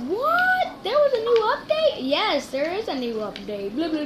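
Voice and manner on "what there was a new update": high, squeaky "What?" in shock; surprised viewer. Pretend. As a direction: play a stunned viewer. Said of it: "You're probably like".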